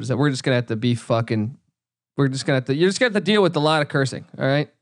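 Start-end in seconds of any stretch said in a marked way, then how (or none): none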